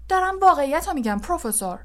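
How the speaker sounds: quick, high-pitched self-defence; indignant protest. Acted. Lee answers the professor's scolding, indignant protest.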